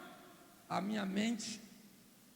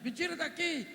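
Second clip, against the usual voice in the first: mock yell; 6 semitones higher than usual. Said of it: "Um defunto gritando lá dentro".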